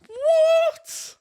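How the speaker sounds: shrieked disbelieving what; a stunned, high yelp of disbelief; incredulous. Real, not acted. Host reacting to the clip with a high incredulous what.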